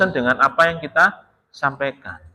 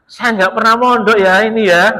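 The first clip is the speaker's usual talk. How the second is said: loud, high announcement to the congregation; mock confession; 7 semitones higher, 6 dB louder than usual. Preacher telling the jamaah of no pondok background, loud mock confession. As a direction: declare it openly, raised and hammed-up.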